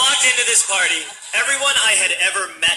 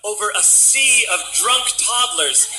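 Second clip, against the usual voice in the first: ordinary talk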